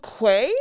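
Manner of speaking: "Quay" as kway, tried out tentatively; chosen pronunciation. Shown as a demonstration. Settling on a guest's name, "Quay" said as kway. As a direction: say "Quay" with an ay, rising as if unsure. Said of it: "I'm gonna go with".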